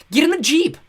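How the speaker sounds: high, loud urgent cry; exclaiming. A narrator's reading. Violet urges her siblings after the fleeing villains, loud. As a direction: yell it out to chase the car. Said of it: "Violet exclaimed".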